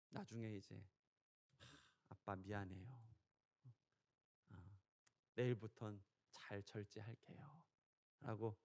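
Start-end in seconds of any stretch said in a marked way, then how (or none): 1.6-7.6 put-on soft, slow, a bit sulky kid voice; lightly voiced apologetic son; sheepish son's apology. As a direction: mumble a reluctant sorry to dad. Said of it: "이제 잘못했다고 얘기를 하죠".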